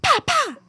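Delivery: very high-pitched "pa, pa"; imitated outburst noise. Crew member showing what she'd do without the mic, high-pitched. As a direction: pop out two high little syllables.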